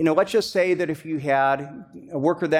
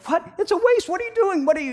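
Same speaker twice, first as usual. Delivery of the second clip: shocked outcry; 13 semitones higher, faster than usual. Retold or imitated. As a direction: cry out in disbelief at the waste. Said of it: "there's a reaction that's a natural reaction".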